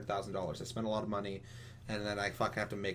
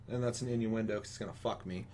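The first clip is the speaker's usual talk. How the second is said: ordinary delivery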